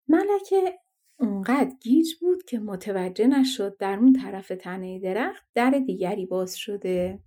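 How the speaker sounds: plain reading voice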